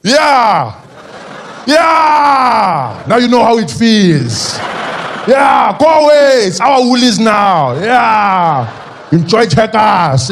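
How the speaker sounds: loud, high gloating cheers with long falling endings. Acted. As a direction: crow it across the street, stretching the words.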